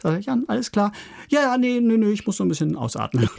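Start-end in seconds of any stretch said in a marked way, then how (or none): 0.2-0.9 hoch und hell fragend; erstaunte Nachfrage. Retold or imitated. Erzählt Gespräch mit Jan. 1.3-3.3 hoch und lauter, hastig abwiegelnd; gespielter Jan, gehetzt. Retold or imitated. Jan imitiert, außer Atem.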